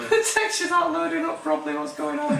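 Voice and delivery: high-pitched repeat. Pretend. Player puzzled by unloaded game textures, high-pitched mock alarm. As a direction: jump up into a high, squeaky register.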